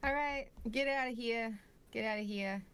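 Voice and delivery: mock New Yorker; New York accent. Shopkeeper shooing customers at closing, New York accent. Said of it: "You from New York?".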